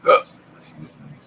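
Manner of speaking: hiccuping mid-sentence; talking through hiccups. Real, not acted. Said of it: "Got the hiccups".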